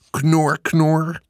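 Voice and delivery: high imitation pig oinking; playful pig grunts. A narrator's reading. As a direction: oink like a child playing pig. Michiel plays at being a pig. (speels knorren als een varken)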